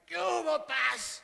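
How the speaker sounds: hammed-up loud street greeting to the pastor; mock casual greeting. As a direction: yell it like a too-familiar buddy. Mocking a too-casual greeting.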